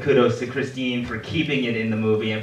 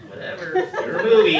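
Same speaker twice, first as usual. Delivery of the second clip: mock shrug. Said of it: "We're like".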